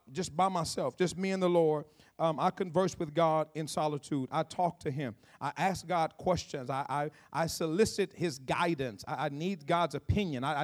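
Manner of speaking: soft, sincere. Acted. Soft slow preaching.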